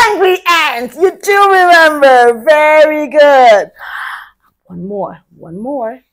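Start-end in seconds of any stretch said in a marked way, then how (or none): 0.1-3.7 excited praise. Acted. Teacher praising kids, high and loud.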